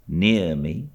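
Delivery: ordinary delivery, nothing marked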